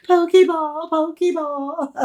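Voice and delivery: giggled through, high and drawn out; laughing with delight at the toy. Real, not acted. Showing viewers her Pokeball, laughing with glee.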